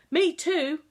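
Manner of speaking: put-on high, loud child's cry; a boy's loud eager cry; cried out. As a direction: call out eagerly from the doorway. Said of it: "cried a voice behind him".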